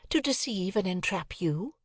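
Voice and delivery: a hint of cold anger; lightly voiced stern young lady; serious Estella. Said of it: "fixed and serious, if not angry, look".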